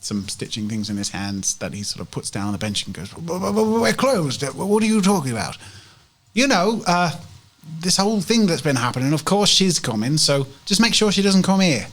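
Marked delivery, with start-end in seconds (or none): 3.1-5.6 high, stammering protest; flustered shopkeeper man. Acted. Game master plays man coming downstairs. 6.3-11.9 impatient shopkeeper woman. Acted. Game master plays woman briefing the man.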